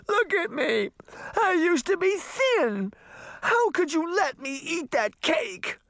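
very high, hammed-up wailing; crying out. A narrator's reading. Princess blames her servants for the cake, high wail. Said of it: "she cried".

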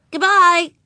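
put-on high, loud child goodbye; little girl voice. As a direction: say it like a grown-up four-year-old. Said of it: "said Ramona".

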